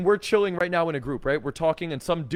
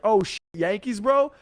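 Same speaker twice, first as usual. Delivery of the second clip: higher, pleasantly surprised bro greeting; loud, friendly; 5 semitones higher, 4 dB louder than usual. Acted. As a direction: call out cheerfully, lifting high on the last word. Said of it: "he was nice and loud, smiling and cool about it".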